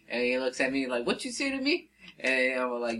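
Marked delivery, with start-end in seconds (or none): 1.1-1.9 high-pitched confrontational fisherman; mock challenge. Fisherman challenging the worker who warned him, mock challenge. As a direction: throw it back as a high, indignant question.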